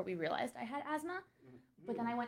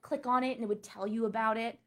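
normal talking voice

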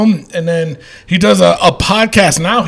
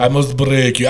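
low Russian movie-villain line; lower-pitched hammy Russian accent; mock Russian accent; 6 semitones lower than usual. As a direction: echo the threat in a deep Russian accent. Echoes the Drago catchphrase.

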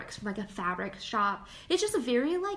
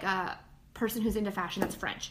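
ordinary talk

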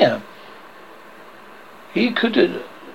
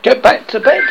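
unsteady; 9 semitones higher, 5 dB louder than usual. A narrator's reading. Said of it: "he said unsteadily".

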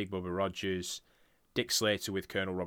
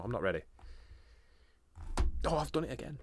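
normal talking voice

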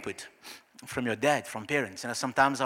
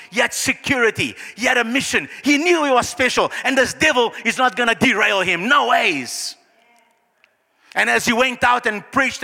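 high, loud, driving sermon climax; rousing pulpit declaration; impassioned preaching; 8 semitones higher, 5 dB louder than usual. Real, not acted. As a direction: preach it at full force, phrase after phrase.

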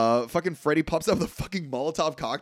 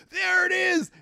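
mock announcement; 4 dB louder, slower than usual. Gator voiced in mock awe.

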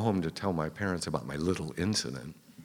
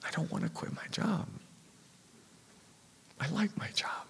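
very high, soft, thin voice; small pleading protest; 12 semitones higher, 6 dB quieter than usual. Retold or imitated. Teenage clerk told to quit job, small high protest.

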